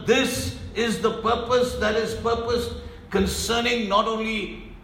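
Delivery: ordinary delivery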